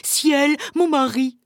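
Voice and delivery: urgent exclamation, high and loud; alarmed cry. Acted. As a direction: cry out as if caught by a husband. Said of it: "s'écria la femme".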